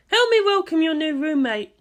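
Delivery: dry welcome for a roommate; lightly voiced Misato. A narrator's reading. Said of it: "Misato said flatly".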